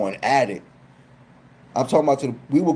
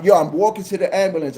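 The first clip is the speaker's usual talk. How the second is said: normal talking voice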